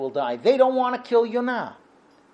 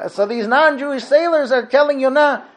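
normal talking voice

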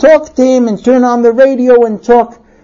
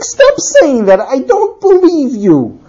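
high-pitched protest, slowing at end; exasperated husband; 5 semitones higher, slower than usual. Acted. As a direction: cut off the apology, voice raised in pitch. Husband rejecting apology in quarrel.

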